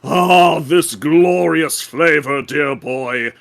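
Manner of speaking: lightly voiced grandiose connoisseur; pompous old gourmand. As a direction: savor each word like a fine wine. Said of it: "back in the voice I was giving this guy".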